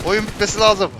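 high, very loud gloating shout; triumphant yell. Acted. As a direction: scream it in victory. Taunt building to peak.

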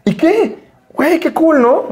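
very high excited boss voice; imitating surprised boss. Boss reacting to the offer, high and amazed. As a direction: squeak it out like the stunned boss. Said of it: "cara de sorprendido me dice".

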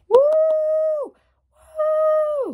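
very high, loud woo; whooping cheer. Real, not acted. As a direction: whoop like a crowd at a concert. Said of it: "I'm getting pumped".

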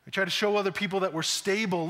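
ordinary talk

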